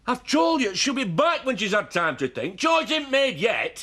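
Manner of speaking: high, heated insistence; angry shouting. Acted. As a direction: insist angrily, pitch pushed up. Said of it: "No point in shouting at her".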